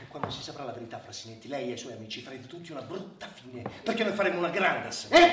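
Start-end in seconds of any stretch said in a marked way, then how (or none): 0.0-3.6 soft, lower, fast menace; hushed, lower threat. Acted. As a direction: warn him quietly and coldly. Doctor quietly threatening the official.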